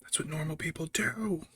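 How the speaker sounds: breathy whispered swear; whispered retort. Retold or imitated. Whispering at the father-in-law on the bench. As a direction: hiss it under your breath. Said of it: "It's like".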